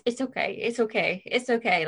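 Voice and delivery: imitating a French grandmother's soothing it's okay; a hint of French granny brushing it off; nan's French accent. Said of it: "It's French, that was an accent".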